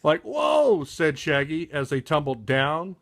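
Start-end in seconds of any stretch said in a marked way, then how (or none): 0.0-0.8 startled whoa. Acted. Tumbling down the slope.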